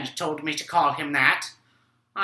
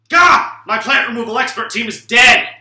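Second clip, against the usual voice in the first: sudden high yelp, then a loud, higher shout; outraged shout; 7 semitones higher, 9 dB louder than usual. Acted. Dad to his son, finding his team dead: comic outraged shout.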